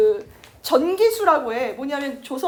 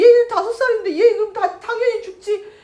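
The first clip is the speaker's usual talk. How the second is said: high, choked-up pleading; tearful protest; 7 semitones higher, 7 dB louder than usual. Retold or imitated. Mother to husband, high tearful pleading. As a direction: weep out the obvious.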